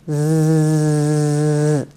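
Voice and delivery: Z as a long, buzzing, voiced hum; vibrant S. Shown as a demonstration. Teacher demonstrates the fly sound to learners, Z long and vibrant. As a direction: hold Z as a sustained vibrating buzz. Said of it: "soft S or a vibrant S".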